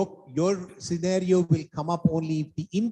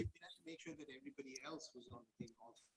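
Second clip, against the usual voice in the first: very faint voice, far from the mic; almost inaudible speech; barely audible; 28 dB quieter, faster than usual. Real, not acted. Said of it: "your voice is very low".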